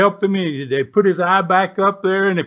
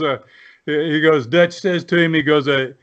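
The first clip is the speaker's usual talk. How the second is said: ordinary talk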